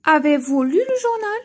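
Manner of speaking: rising question tune for pupils; question intonation. Shown as a demonstration. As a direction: lift it into a clear spoken question.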